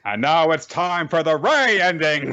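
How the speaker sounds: high, hammed-up ending announcement; mock announcer. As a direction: announce it like a show's next segment. Announces imagined alternate ending.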